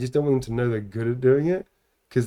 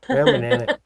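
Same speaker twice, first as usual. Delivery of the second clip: higher, put-on American "brown banana"; lightly American, delighted; American accent; 12 semitones higher, 5 dB louder than usual. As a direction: repeat the kid's American line with glee. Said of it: "she went".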